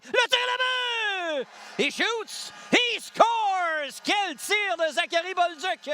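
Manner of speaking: drawn-out shout of le but; excited goal call. Real, not acted. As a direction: belt out he shoots he scores. Goal call by play-by-play announcer. (cri de but prolongé)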